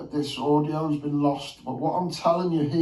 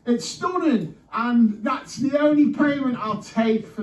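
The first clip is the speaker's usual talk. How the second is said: excited; 8 semitones higher, 3 dB louder than usual. Real, not acted. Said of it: "can you tell".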